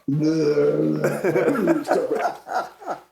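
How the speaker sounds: a burst of real laughter; rising amused laugh. Real, not acted. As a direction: laugh out loud at the memory. Laughing at himself.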